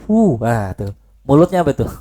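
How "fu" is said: fu with the lips raised and rounded. Shown as a demonstration. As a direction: push the lips up on fu.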